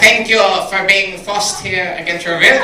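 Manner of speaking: put-on light German-accented officer voice. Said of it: "my impression of a Nazi stand-up comic".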